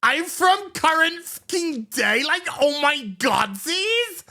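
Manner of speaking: put-on high, ditzy valley girl voice, opening with a shout; mock her as a shallow, whiny teen; mocking cadet girl. Reviewer mocks the cadet in debate class, high ditzy whine. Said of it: "And she's like".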